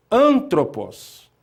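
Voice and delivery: "Antropos" slowly, first syllable drawn out and loud; stress on first syllable. Shown as a demonstration. Teacher showing students the accented syllable, "Antropos" stressed on AN. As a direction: hit the AN of "Antropos" hard and stretch it. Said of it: "essa palavra leva um acento agudo na".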